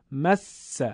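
"مس" with the s held long and strong; emphatic doubled consonant. Shown as a demonstration. Teacher correcting the mistake, long emphatic س in "مس". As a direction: press and linger on the س in "مس". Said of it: "با تأکید نگفته".

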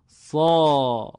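«صا» held long with a thick, full vowel; drawn-out heavy Arabic «صا»; heavy long alef. Shown as a demonstration. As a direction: stretch «صا» dark and full. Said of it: "اگر الف مدی هم داشته باشن".

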